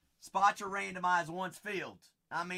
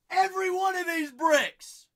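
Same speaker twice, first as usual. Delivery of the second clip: high, loud holler; hype shout; 12 semitones higher, 10 dB louder than usual. Real, not acted. Host hyping brick by brick, shouting. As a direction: holler it out high to fire up viewers.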